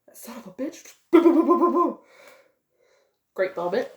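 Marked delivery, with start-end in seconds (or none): 0.0-1.0 breathy whispered muttering of a curse. Retold or imitated. Viewer whispering a curse at the screen, hushed. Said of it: "You're like". 1.1-2.0 loud high mouth gunfire booms, laughing; gunshot noises. Retold or imitated. Retelling the shootout scene, loud mock gunfire.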